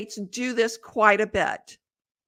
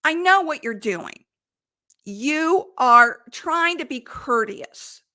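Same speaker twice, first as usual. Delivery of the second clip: usual speaking voice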